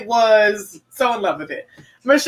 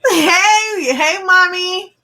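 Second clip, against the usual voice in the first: high, louder call of hey, hey, Mommy; excited greeting; 8 semitones higher, 3 dB louder, much slower than usual. Real, not acted. Host greets a friend who joined the chat, calling out excitedly.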